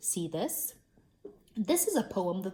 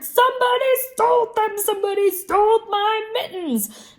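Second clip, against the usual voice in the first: high, loud frantic yell; a little girl yelling about her stolen mittens; hollering; 13 semitones higher, 11 dB louder than usual. A narrator's reading. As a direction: scream it like the world is ending. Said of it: "I hollered".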